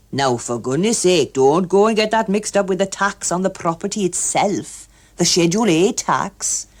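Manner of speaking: lightly voiced fussy official; emphatic official. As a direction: insist like a fussy tax man. Hughes Evans insists.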